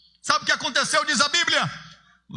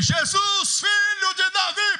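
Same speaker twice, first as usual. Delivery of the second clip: desperate cry; 8 semitones higher, slower than usual. Acted. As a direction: call out desperately across the crowd. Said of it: "Aí ele".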